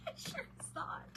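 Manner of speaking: high breathy squeal of laughter; laughing squeal. Real, not acted. Squealing laugh at own claim.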